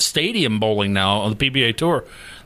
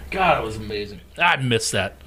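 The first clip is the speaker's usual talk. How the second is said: ordinary talk, nothing marked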